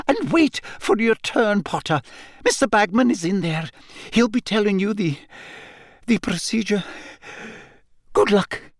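high, shaky, halting with nerves; shaky McGonagall. A narrator's reading. Professor leaving Harry at the tent, shaky. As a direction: falter between phrases, voice high and shaky. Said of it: "in a rather shaky sort of voice".

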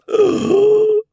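huge high theatrical gasp; loud, hammed-up gasp of delight; excited gasp. Esme thrilled by her own gift, excited gasp.

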